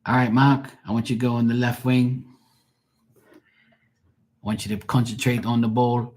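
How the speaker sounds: put-on Scottish accent, urging the players; Scottish dad accent. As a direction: imitate a Scottish dad instructing his team. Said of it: "my friend's dad's from Scotland".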